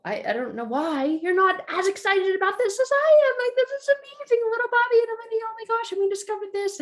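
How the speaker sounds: put-on high child voice, racing at the end; excited kid. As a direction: sell how amazing it is, like a hurt little kid. Child protesting mom's dismissal.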